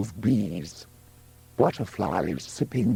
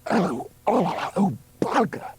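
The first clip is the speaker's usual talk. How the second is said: shouted in pain; 10 semitones higher than usual. A narrator's reading. Woman in labour gripping his hands, shouting. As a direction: cry out high as the pain hits. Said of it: "Juno shouted".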